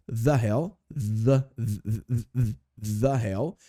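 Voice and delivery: The hell said as "thah hell", the TH drawn out and repeated; pronunciation demo. Shown as a demonstration. Teacher to students, drilling the TH sound. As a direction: drill the "the" in The hell, then say the whole phrase. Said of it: "Olha só a pronúncia".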